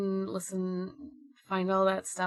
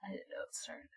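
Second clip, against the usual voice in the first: whispered aside; 16 dB quieter, much faster than usual. Real, not acted. Quiet aside about Hanukkah timing.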